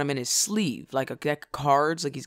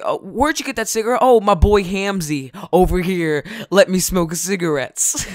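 hammed-up boy; 3 semitones higher, 5 dB louder than usual. Showing off to an imagined friend about the cigarette, hammed-up. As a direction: ham it up as an imagined kid, pitched up.

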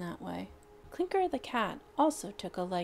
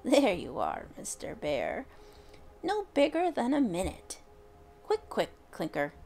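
put-on an old witch, gleeful and bossy; old witch ordering her cat to fetch the bear; lightly voiced old witch; 4 semitones higher than usual. A narrator's reading. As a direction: shoo the cat off with a hint of witch. Witch Roxy voiced in story.

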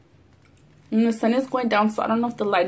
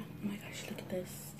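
soft awed whisper; whispered awe at the mochi; 21 dB quieter than usual. Real, not acted. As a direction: hush it in wonder. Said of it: "whispering over food".

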